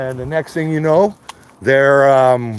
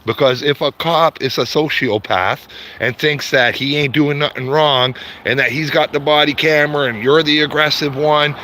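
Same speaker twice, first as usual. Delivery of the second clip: normal talking voice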